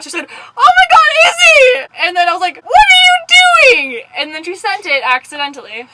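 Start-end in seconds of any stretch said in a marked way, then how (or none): 0.6-1.9 high, loud panicked cry; freaking out. Retold or imitated. Retelling friend's panic at the accidental send, mock freak-out. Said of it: "she was freaking out". 2.6-4.0 high, loud, stretched-out scolding; mock angry yell. Retelling scolding her friend over the voice message, mock yell. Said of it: "and I yelled at her".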